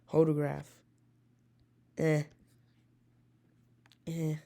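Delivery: normal talking voice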